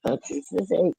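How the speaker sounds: high altered squeaky voice; voice changer. Pretend. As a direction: say it through a chipmunk voice changer.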